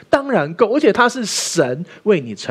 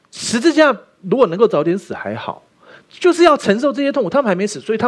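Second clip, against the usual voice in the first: rising intensity; 7 semitones higher, 4 dB louder than usual. Real, not acted. Preacher to congregation, rising impassioned voice. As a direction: voice climbs higher and louder.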